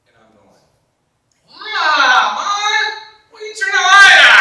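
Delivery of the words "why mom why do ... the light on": put-on high, loud woken-child cry; whiny shouting ten-year-old; startled yell. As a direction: yell like a sleepy kid blinded by light. Woken boy yelling at mom.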